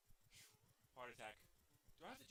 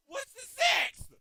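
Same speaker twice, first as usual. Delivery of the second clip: high, loud, drawn-out question; incredulous raised voice; exasperated question; 13 semitones higher, 10 dB louder, slower than usual. Real, not acted. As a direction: call it out slowly in disbelief.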